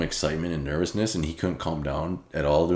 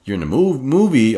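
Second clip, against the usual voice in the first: flustered fan; 9 semitones higher, 9 dB louder than usual. Retold or imitated. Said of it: "He would keep on stuttering".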